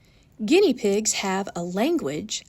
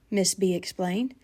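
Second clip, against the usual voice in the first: plain reading voice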